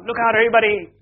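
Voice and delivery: high, loud acted yell; yelled warning. Soldiers tricking Ben with a grenade warning, yelling. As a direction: yell out the alarm as storytelling. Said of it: "yelled out".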